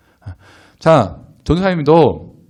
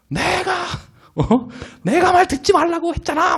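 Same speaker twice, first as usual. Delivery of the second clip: mock outburst of an exasperated dad; imitated angry father; 4 dB quieter than usual. Father scolding child over the phone about car repairs, imitated anger. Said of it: "막 이렇게 막 화를 내시는 거예요".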